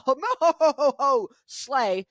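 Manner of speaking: booming, laughing ho-ho-ho; Santa Claus. Acted. Host riffing on slay, a jolly Santa laugh. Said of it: "It's all Santa Claus".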